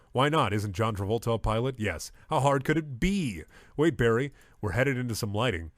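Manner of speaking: usual speaking voice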